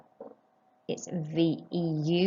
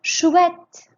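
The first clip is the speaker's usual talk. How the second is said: much higher and louder "Chouette"; teacher models delight, louder still; delighted exclaim; 11 semitones higher, 10 dB louder than usual. Shown as a demonstration. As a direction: exclaim it like great news. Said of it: "Écoutez et répétez".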